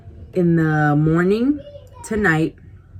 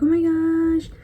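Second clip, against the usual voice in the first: much higher, breathless surprise; delighted gasp; 12 semitones higher, slower than usual. Retold or imitated. Receiving a big teddy bear, delighted surprise. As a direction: squeal it, stunned by the gift. Getting first gift ever.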